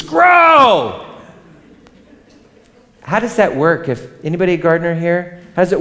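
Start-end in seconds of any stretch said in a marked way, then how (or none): 0.0-1.0 high, loud yell of "Grow!"; screamed command. Retold or imitated. Frantic gardener screaming at the roots, loud and high. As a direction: scream it at the plants. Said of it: "I scream at the roots".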